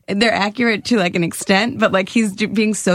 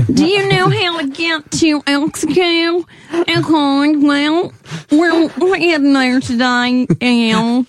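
slow, high-pitched hammed-up North Dakota accent; exaggerated North Dakota impression, pitched up and drawn out; 6 semitones higher, slower than usual. Shown as a demonstration. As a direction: ask directions as a singsong North Dakotan, stretching vowels. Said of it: "is pretty like".

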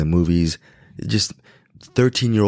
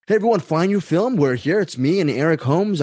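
excited intro; 9 semitones higher than usual. Real, not acted. Excited podcast intro greeting.

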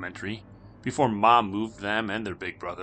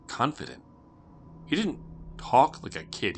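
plain reading voice

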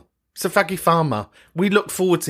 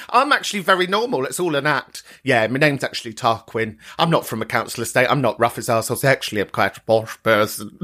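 poshest voice; 4 semitones lower than usual. Pretend. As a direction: lower put-on upper-class English accent, hammed up. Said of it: "That was the poshest voice that I can even muster up".

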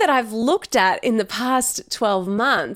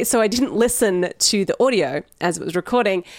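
ordinary delivery

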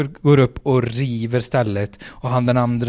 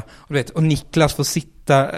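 usual speaking voice

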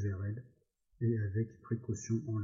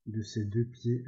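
plain reading voice

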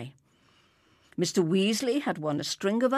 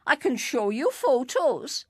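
raised, louder insistence; insistent protest; 5 semitones higher, 4 dB louder than usual. A narrator's reading. Stranger defending his boast to Enoch Davis, insistent. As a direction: protest with offered proof.

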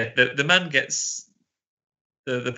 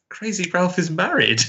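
higher, lightly voiced astonished aside; mock disbelief; 7 semitones higher than usual. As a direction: act amazed at the news. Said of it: "And I'm like".

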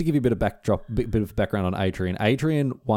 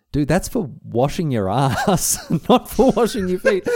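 laughing disbelief; 6 semitones higher, 4 dB louder, slower than usual. Retold or imitated. Laughing at friend's foot-washing mistake.

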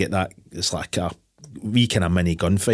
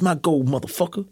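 higher-pitched movie-quote voice; mock gangster claim; 8 semitones higher than usual. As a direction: lightly act out a gangster's claim. Voicing gangster claiming the gold.

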